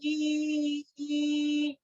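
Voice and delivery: ई held long and soft. Shown as a demonstration. Teacher holding the ई sound for pupils. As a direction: sustain ई. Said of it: "ई का sound सुनाई दे रहा है ना".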